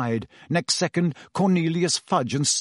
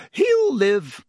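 grim, high and firm; 10 semitones higher, 5 dB louder than usual. A narrator's reading. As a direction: deliver it grimly, pitched up. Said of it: "said Madame Pomfrey grimly".

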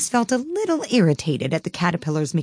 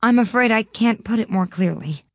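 polite; 6 semitones higher, 3 dB louder than usual. A narrator's reading. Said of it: "Alice replied very politely".

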